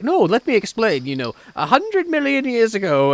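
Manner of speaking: mock earnest explaining in a high voice; William Hartnell. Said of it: "And Hartnell's like".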